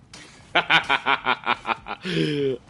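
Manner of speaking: slightly high, rapid chuckling; amused run of ha-has; staccato laugh. Real, not acted. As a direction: laugh in short, even pulses. Laughing again at the loop.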